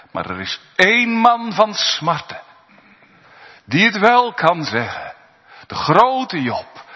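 high, loud preaching swell; fervent pulpit proclamation; rising sermon. Acted. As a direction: lift the congregation with fervour.

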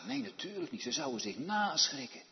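normal talking voice

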